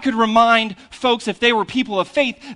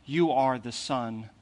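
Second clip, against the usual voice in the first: scripture reading; 7 semitones lower, 8 dB quieter than usual. Acted. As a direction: read the gospel gently to the congregation. Reads the scripture passage.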